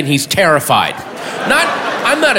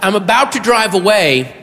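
normal talking voice

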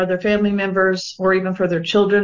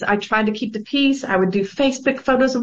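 ordinary talk

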